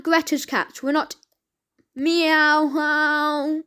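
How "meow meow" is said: long meow held out mockingly; laughing cat meow. A narrator's reading. Unscared cats laugh at the growling dog, laughing meow. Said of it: "they actually laughed".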